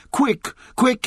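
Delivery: high-pitched urgent call; Benjamin's urgent shout. A narrator's reading. Donkey calling the animals from work, urgent shout. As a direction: raise the alarm, pitched well up. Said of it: "he shouted".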